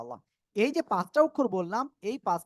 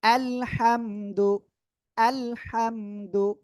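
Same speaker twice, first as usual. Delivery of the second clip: very slow, stretched syllables on a held pitch; drawn-out model reading; 2 semitones higher, 4 dB louder, much slower than usual. Shown as a demonstration. Teacher modelling আলহামদু for the class, drawn-out recitation.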